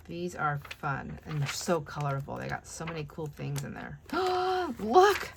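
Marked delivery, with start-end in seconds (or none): 4.0-4.7 sudden high excited gasp; sharp gasp. Real, not acted. Excited find in a magazine. 4.8-5.3 high, loud excited cry. Real, not acted. Excited find in a magazine.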